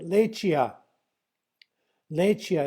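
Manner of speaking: "Lechia" said carefully for learners; "Lechia" sounded out as lay-chee-ah; pronunciation demo. Shown as a demonstration. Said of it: "you can try and say".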